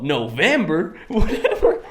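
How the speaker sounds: laughing through a bad Southern cowboy accent; hammed-up Southern twang, breaking into a laugh. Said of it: "This is not what they sounded like at all".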